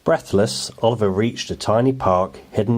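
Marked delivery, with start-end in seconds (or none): none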